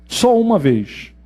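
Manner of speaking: Só uma vez said slowly and deliberately; slow emphasis. Shown as a demonstration. Teacher repeating the rule: Só uma vez, slow and deliberate. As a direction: drag out Só uma vez to drive it home. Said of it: "Mas uma única vez".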